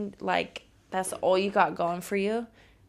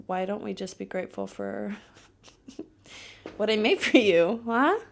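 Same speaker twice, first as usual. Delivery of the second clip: usual speaking voice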